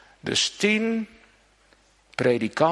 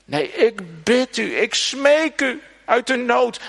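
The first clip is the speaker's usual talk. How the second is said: high, louder begging voice; desperate pleading; 10 semitones higher, 4 dB louder than usual. Retold or imitated. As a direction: beg urgently out of need. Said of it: "die vader van die maanzieke jongen". (vader die Jezus smeekt om zijn zieke zoon: hoog smekend)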